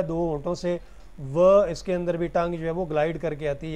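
usual speaking voice

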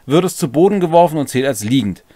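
normal talking voice

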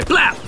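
single high popped syllable; gunshot imitation. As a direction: mimic one rifle shot.

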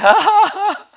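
high, loud put-on laugh; mock laughing. People laughing at the name of Jesus, high mock laugh. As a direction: laugh it out high like a giddy believer. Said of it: "they're like".

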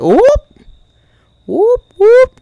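high, loud rising whoops; mock police siren. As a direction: whoop like a siren, shooting up in pitch. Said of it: "That's the sound of Paw Police".

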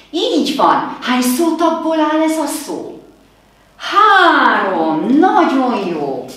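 high, loud, beaming cheer; excited teacher praise. Acted. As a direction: celebrate the kids' answer.

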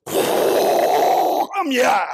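loud, high announcing arrival; grand entrance. Retold or imitated. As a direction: sweep in and announce yourself. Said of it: "When they arrive, it's".

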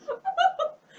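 shrieking laugh; high shrill laugh out loud; high burst of laughter. Real, not acted. Laughing at filter.

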